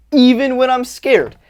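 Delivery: loud, high and slow, driven home; emphatic declaration. Shown as a demonstration. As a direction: punch out "Even when I'm scared" loudly and slowly. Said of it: "Hear what I'm saying right now".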